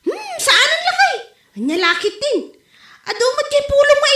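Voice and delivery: high, laughing protest. Real, not acted. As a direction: laugh through the denial. Wife laughs at husband's question.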